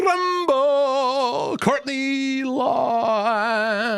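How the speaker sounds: high, very slow drawn-out names; stretched announcer welcome; announcer call. Acted. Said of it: "stretched welcome call".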